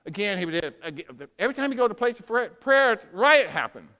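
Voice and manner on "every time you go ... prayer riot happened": faster, louder, building to riot; hurried build to a loud riot; excited rush. Real, not acted. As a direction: rattle it off excitedly.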